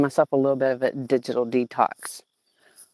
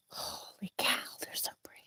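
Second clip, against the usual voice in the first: awed whisper; 7 semitones higher, 18 dB quieter than usual. Real, not acted. Awed over the peppers.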